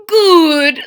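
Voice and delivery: loud, high approving mother; a cheerful mama nod; Mama's voice. Acted. Mama approves her son's choice, loud and high.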